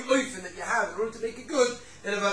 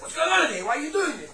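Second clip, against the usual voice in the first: upset father; 7 semitones higher, 6 dB louder than usual. Retold or imitated. Upset father confronts his kids.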